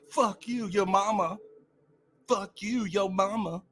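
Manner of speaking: high mock yo-mama taunt; mocking the trolls. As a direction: ham up their lame insult.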